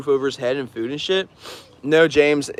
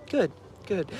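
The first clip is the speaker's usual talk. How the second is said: soft, breathy, much quieter mutter; relieved murmur; 5 semitones higher, 9 dB quieter than usual. Retold or imitated. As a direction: murmur it to yourself, satisfied. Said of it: "And she's like".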